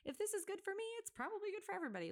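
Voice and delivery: inner voice quote. Retold or imitated. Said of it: "I'm like".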